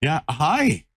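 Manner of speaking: high, sing-song puppy talk. Real, not acted. Cooing at the puppy.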